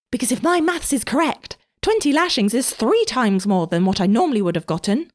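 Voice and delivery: put-on high, angry boy voice. A narrator's reading. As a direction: mimic their words with anger. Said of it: "Kion said angrily".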